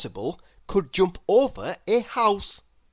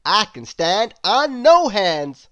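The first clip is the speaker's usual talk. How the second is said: higher, louder boastful voice; loud proud storybook boast; Mister Impossible boasting; 5 semitones higher, 9 dB louder, slower than usual. A narrator's reading. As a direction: brag it like nothing is beyond you. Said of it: "replied Mister Impossible".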